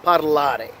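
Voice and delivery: "parlare" stressed on LA, the second syllable. Shown as a demonstration. Teacher repeating the example for learners, second syllable of "parlare" stressed. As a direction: put the weight on LA in "parlare". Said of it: "falling on the second syllable".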